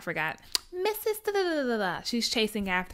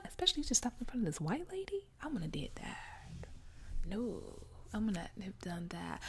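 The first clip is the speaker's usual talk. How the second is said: hushed, secretive whisper; 12 dB quieter than usual. Real, not acted. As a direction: lean in and whisper it. Whispered asides about the slap.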